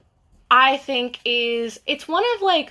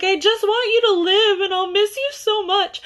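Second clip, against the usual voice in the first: mock crying; 10 semitones higher than usual. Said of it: "horrified and crying".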